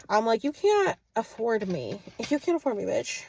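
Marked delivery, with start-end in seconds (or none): none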